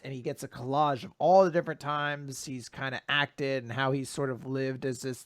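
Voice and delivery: ordinary talk, nothing marked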